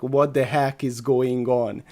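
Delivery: normal talking voice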